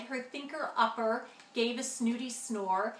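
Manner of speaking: ordinary delivery, nothing marked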